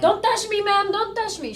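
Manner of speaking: frightened woman voice. Retold or imitated. Driver after the crash pleading, high and frightened. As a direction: imitate her backing away in fear. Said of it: "scared woman after accident".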